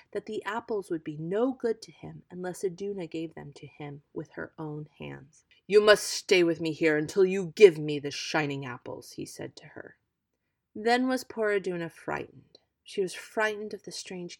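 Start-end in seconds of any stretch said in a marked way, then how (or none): 5.7-9.1 louder, stern order; threatening giant; commanding giant. Acted. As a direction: keep her captive. Giant imprisons her.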